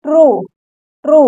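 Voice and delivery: "ट्रू" modelled twice as a separate word; teacher repeating "ट्रू" with an oo ending; long oo. Shown as a demonstration. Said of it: "ओ का long sound".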